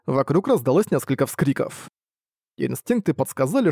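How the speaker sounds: ordinary delivery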